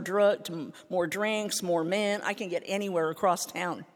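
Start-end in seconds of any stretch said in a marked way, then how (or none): none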